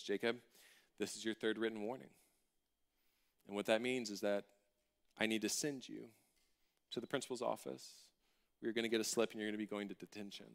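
low, hushed pronouncement; grave teacher verdict. Retold or imitated. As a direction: deliver the sentence softly, with measured pauses. Teacher solemnly delivers the punishment.

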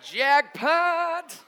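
high, loud exultant cry; gleeful triumph. Retold or imitated. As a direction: crow it like a winning discovery. Delight at sensing the crush.

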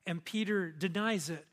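ordinary talk

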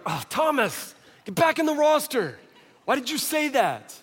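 high-pitched mock exasperation; exasperated. Apostles scolding Thomas for asking, high and exasperated. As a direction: groan his name, then scold in a high voice.

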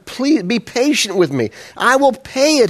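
high, slow plea to a fellow servant; pleading. A narrator's reading. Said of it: "he pleaded".

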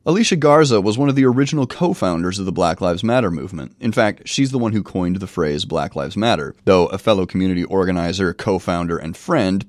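normal talking voice

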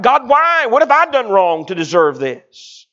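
high, loud anguished cry; father crying out to God in the hospital, anguished. Retold or imitated. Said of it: "I began crying out".

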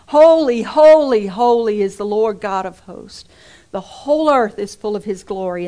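loud proclaiming cry of praise; ringing shout of holy praise; angels crying out. Retold or imitated. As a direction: call it out like the seraphim, full voice.

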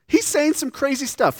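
laughing through the words, high and loud. Real, not acted. Preacher laughing to the congregation about crazy claims, amused. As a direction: chuckle it out in disbelief.

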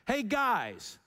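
high, casual call of guys; casual hail. Shown as a demonstration. Preacher shows the Greek as a casual hey guys. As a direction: say guys like hailing buddies. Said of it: "how it should be translated".

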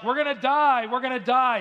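high, loud mock-panic wail; hammed-up cry of doom from a stranded fisherman; retold panic. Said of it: "He was panicking. He was scared".

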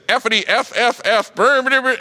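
imitated online gamer gibberish; gamer chatter imitation. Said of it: "all of a sudden I hear this".

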